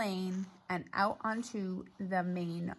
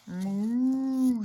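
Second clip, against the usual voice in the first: cow moo; 3 semitones higher, 6 dB louder than usual. Acted. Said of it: "said Cow".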